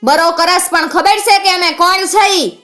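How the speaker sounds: very high-pitched menacing challenge; burn with wounded authority; fiery threat. A narrator's reading. Outlaw challenges the defiant widow, fiery threatening voice. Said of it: "angara જેવા અવાજે બોલ્યો".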